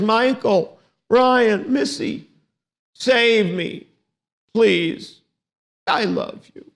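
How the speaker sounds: put-on pleading little girl. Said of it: "she says".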